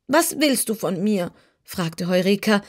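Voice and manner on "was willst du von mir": lightly voiced cat, a touch uneasy; the cat gives in, a bit worried; uneasy kitten. A narrator's reading. Kitten, shaken by the threat, asks Dorothy warily. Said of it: "von dieser Drohung beunruhigt".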